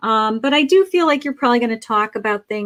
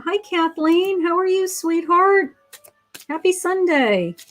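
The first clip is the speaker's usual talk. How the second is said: sweet high greeting; 6 semitones higher than usual. Real, not acted. Psychic greets viewer sweetly.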